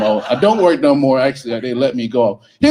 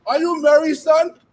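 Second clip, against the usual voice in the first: mock party auntie; 7 semitones higher, 6 dB louder than usual. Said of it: "I go to the parties, like".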